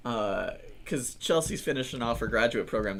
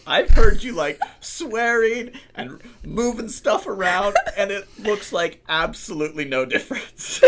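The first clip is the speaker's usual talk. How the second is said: retelling the wife's words through laughter, higher pitch; laughing wife; 7 semitones higher, 7 dB louder than usual. Retold or imitated. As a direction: imitate her cracking up mid-sentence. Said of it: "she just starts laughing".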